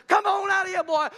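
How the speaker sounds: loud, high shout; joyful call. Pretend. Goodness and mercy calling Elijah home, a loud joyful shout. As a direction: call him out with a glad holler. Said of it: "goodness and mercy ... says".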